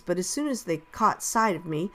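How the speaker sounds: ordinary delivery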